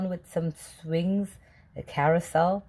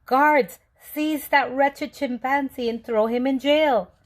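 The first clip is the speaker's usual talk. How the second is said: wailing; 6 semitones higher, 4 dB louder than usual. A narrator's reading. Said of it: "the mayor began to wail".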